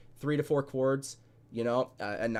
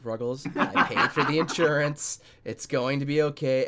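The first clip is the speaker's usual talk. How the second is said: louder, chuckling through it, telling a friend it's fine; laughing reassurance; 3 semitones higher, 6 dB louder than usual. Retold or imitated. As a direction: laugh it off to calm him down. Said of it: "I'm like".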